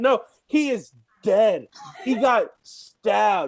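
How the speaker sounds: slow, deliberate insisting; stubborn slow emphasis; slow insistence. Real, not acted. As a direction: spell it out slowly like it's obvious. Insisting after being interrupted.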